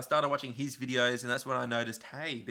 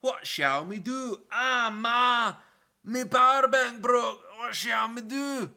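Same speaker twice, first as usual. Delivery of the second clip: high, slow hammed-up Scottish accent; mock Scottish accent; 10 semitones higher, 6 dB louder, slower than usual. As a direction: play a whiny Scot in a Scottish accent. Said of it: "with the accent".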